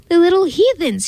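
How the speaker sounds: a hint of a furious yell; shout. A narrator's reading. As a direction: shout it like a man in a rage, lightly. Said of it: "he shouted".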